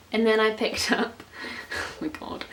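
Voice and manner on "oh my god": breathless whispered oh my god; a hushed aside to herself. Real, not acted. Vlogger embarrassed by her own pick, whispering.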